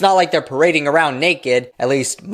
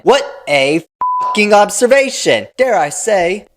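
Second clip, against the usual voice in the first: sarcastic; 6 semitones higher, 4 dB louder than usual. Real, not acted. Sarcastic mock praise.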